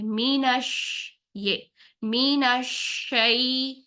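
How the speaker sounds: مینش slow, the ش spread with breath into شعی; breathy shin. Shown as a demonstration. Teacher linking مینش into شعی. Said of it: "مینش کرتے کرتے ہم شعی کریں گے".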